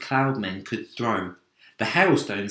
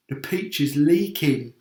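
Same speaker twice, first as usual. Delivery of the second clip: shout; 4 semitones higher, 4 dB louder than usual. Acted. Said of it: "shouted the old green grasshopper".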